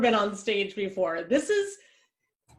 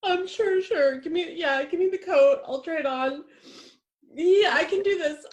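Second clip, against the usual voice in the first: nervous fake bravado; 9 semitones higher, 3 dB louder than usual. Nervous, close to tears.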